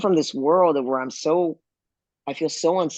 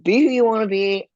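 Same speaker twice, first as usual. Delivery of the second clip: raised, louder declaration; emphatic acceptance; 8 semitones higher, 5 dB louder than usual. Retold or imitated. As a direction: proclaim it freely, pitched up high. Voices own carefree stance emphatically.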